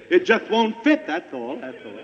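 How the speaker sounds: ordinary delivery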